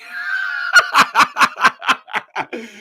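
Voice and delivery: high, loud burst of laughter; a howling high laugh; shrieking laugh. Real, not acted. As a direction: cackle out loud at the absurdity. Laughing at own fairy tale.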